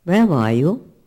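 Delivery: loud, booming demand; confronting questions at full voice; God. Retold or imitated. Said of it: "then God says to Adam".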